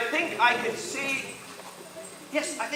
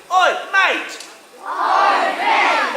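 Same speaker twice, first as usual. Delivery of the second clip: Cockney "Oi, mate"; 6 semitones higher, much slower than usual. Shown as a demonstration. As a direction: hail a mate like a London Cockney.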